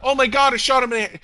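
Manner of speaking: high, loud gloating imitation; mock excitement. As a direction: imitate an overexcited player crowing over a headshot. Mimics cheaters celebrating headshots.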